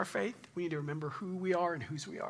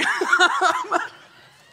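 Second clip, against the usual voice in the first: high loud laugh; 15 dB louder than usual. Real, not acted. Laughing at own story.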